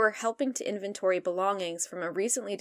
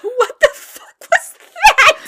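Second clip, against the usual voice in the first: squealing high and loud through laughter; laughing disbelief; 16 dB louder, slower than usual. Real, not acted. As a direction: crack up mid-question. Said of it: "That was me trying to be Donald Duck".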